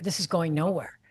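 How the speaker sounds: muttered aside. Retold or imitated. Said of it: "you're like".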